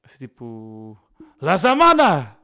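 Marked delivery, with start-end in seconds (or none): 1.4-2.4 loud, high burst of fake Turkish; mock Turkish. Shown as a demonstration. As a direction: shout a made-up Turkish greeting. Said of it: "Como é que se diz olá em turco?".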